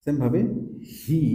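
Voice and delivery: ordinary delivery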